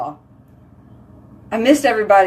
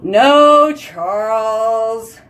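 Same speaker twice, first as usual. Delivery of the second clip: calling out; 4 dB louder, much slower than usual. Real, not acted. Answering Charles, loud and drawn out. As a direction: call out and stretch the name.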